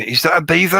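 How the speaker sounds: high, loud startled call of disbelief; surprised question. Retold or imitated. Watcher in the wild spots a beaver, startled and unsure. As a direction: ask it like you just glimpsed one.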